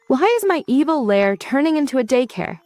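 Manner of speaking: high, swooping villain complaint; dramatic exasperation. A narrator's reading. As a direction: lament it theatrically, pitch swooping up. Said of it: "Eclipse sighs dramatically".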